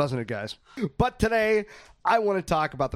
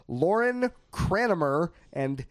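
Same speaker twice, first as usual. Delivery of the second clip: sounding out names; 2 semitones higher, much slower than usual. Real, not acted. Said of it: "way to sound it out".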